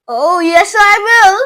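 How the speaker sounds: high, loud tiny-voiced retort; squeaking. A narrator's reading. Shrunken boy squeaks back at his father. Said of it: "squeaked the tiny voice".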